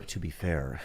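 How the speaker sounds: low, soft public radio reporter voice; a hint of a calm radio reporter; NPR voice. Shown as a demonstration. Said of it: "You're even using your N P R voice".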